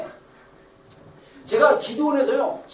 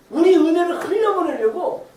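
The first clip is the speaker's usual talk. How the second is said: ordinary talk, nothing marked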